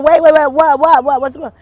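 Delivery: loud, high, rapid-fire alarm; startled, shouted reenactment of protest. Retold or imitated. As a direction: cry out in panic as if grabbed, words tumbling. Retelling being shoved into a car.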